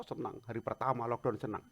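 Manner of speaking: ordinary delivery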